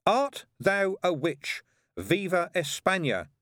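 slow, high, drawn-out proclamation; pompous playground interrogation; child playing Chief Inquisitor. A narrator's reading. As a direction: intone it grandly as a pretend inquisitor.

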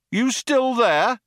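high, slow call. A narrator's reading. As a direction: call out after the barrage. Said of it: "called one of the cops".

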